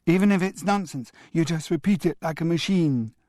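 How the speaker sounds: put-on high, eager boy's voice; a small twin chiming in; child agreeing. A narrator's reading. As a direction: Damien joins his brother, high and eager. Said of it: "agreed Damien".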